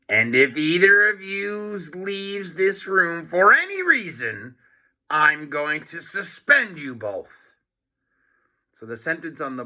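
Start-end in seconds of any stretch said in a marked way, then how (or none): 0.1-7.5 evil grin. Acted. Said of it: "said with an evil grin".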